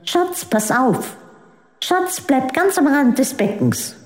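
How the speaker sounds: anxious mother calling out; mother's voice. A narrator's reading. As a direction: warn the child like a watchful mother. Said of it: "die Stimme ihrer Mutter".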